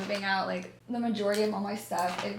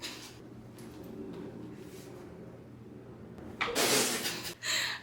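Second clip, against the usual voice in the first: soft breathy fake giggle; teasing whispered giggle; mock giggle; 7 dB quieter than usual. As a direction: giggle at the camera to tease him. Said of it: "I'm just gonna be like".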